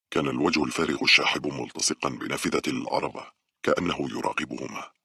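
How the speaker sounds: ordinary delivery, nothing marked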